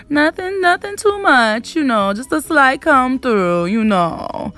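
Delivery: high, sassy Southern Charleston drawl; Charleston accent. Acted. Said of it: "in my Charleston voice".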